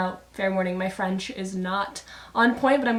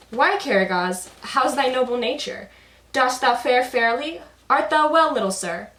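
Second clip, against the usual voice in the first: higher, louder, archaic and grand; pompous Hasavat; 4 semitones higher, 6 dB louder than usual. Acted. Hasavat fussing over Karagos in flowery speech, pompous and loud. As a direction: lay the old-fashioned courtesy on thick. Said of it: "the very worldly one".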